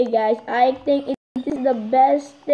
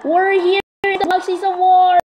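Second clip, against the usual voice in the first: thrilled arrival, voice pitched up high; excited; 5 semitones higher than usual. Real, not acted. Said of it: "I'm so excited".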